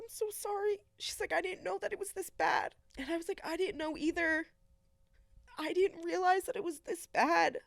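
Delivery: high voice, close to crying; tearful. Real, not acted. Retelling mom's apology and own admission, tearful. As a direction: push on through the tears. Tearful exchange with her mother.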